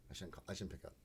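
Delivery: reluctant mutter. Retold or imitated. Inner thought after sigh.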